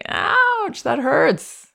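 high, yelped ouch; imitating a hurt child crying out; baby's protest. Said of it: "he should be able to say".

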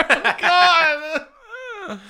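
ordinary delivery

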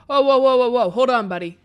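loud startled whoa, hold on; startled shout. Acted. Moose rears up and shouts at startled officer, loud startled whoa.

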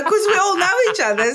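ordinary talk

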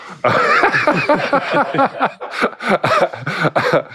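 big high-pitched laugh. Real, not acted. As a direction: bursts out laughing. Laughing at his own story.

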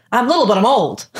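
child's weird flex, mildly acted; quoting Dill. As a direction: say it like a tiny boy bragging. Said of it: "What did he say?".

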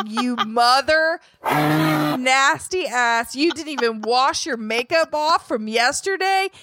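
slow, disgusted mock tirade; slow hammed-up self-disgust tirade ending in a fake sob; imagined harsh insults. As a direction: drag each insult out like a harsh inner critic. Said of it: "here's what I heard".

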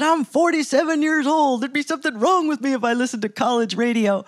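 lightly imitated Southern drawl; amused Southern drawl, explaining himself. Southern producer explaining to an interviewer, in a Southern drawl. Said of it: "he said in that southern drawl".

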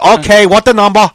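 loud, high mock clerk voice; irritated takeout worker parody; mad order-taker. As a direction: demand it impatiently as a rude clerk. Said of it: "and they get mad".

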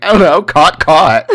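loud, high put-on confusion; mock bafflement. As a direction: blurt it out as if baffled by the quiz. Retells own baffled quiz answer.

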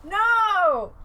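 high loud No; horrified shout. Real, not acted. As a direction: yelp it in horror. Seeing otter photo.

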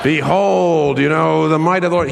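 grand theatrical command shouted to the heavens; Charlton Heston as Moses. Retold or imitated. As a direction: ham up the Hollywood prophet parting the sea. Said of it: "even Charlton Heston, when he was playing Moses".

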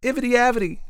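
high, loud shout of the name; children crying out. Acted. Some children shouting for their story, high and loud. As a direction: yell out your pick. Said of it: "cried some".

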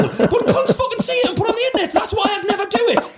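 high, laughing, emphatic rant. Real, not acted. Comedian explains why he never snaps at staff, laughing rant.

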